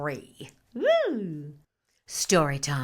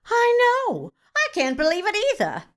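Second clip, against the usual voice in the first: squealing disbelief; 13 semitones higher, 5 dB louder than usual. Acted. Acted phone gossip, squealing.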